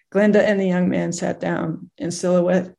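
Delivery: usual speaking voice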